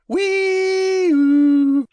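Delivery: high, loud siren wail; loud drawn-out vocal siren; screaming fire engine. A narrator's reading. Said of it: "the screaming of the fire engine".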